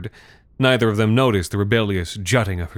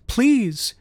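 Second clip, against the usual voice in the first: the second please higher and more urgent; girl begs her parents again, higher and more urgent; begging child. A narrator's reading. Urgent high begging. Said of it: "Madeline begged".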